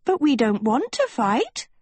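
put-on high, girlish protest; high-pitched, earnest objection. Sophie protests to schoolmaster.

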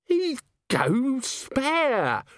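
very high, drawn-out wail; hopeless dread. Acted. Nobby cannot execute Vimes, wailing in dread. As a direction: moan it slowly, word by word.

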